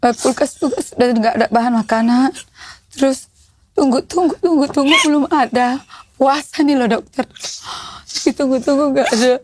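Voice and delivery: telling it while close to crying; tearful. Real, not acted. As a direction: voice thick with tears, holding back sobs. Mother recounting hardship, crying. (suara menahan tangis)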